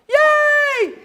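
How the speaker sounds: very high, loud yay; squealing crowd cheer. Acted. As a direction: cheer with glee.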